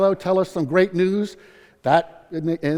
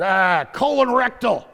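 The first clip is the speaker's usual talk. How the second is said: put-on slow grumbling old patient, pitched up; grumpy old man; 6 semitones higher, slower than usual. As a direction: grump it out like a sour old man in a waiting room. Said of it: "the guy grumps at me".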